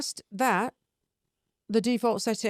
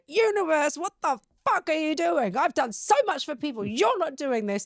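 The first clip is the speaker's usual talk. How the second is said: higher-pitched mock outburst at the universe; acted-out venting; annoyed ranting; 7 semitones higher than usual. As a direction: rant upward in exasperation, stopping short of a scream. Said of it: "it's also my scream room".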